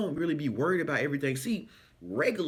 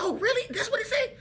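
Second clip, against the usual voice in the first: high, laughing disbelief; surprised winner; 3 dB louder than usual. Retold or imitated. Winner hearing the amount, high delighted surprise. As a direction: gasp it out, can't believe it. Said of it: "They be like".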